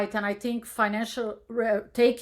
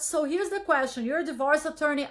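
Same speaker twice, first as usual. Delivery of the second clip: ordinary delivery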